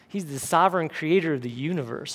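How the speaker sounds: usual speaking voice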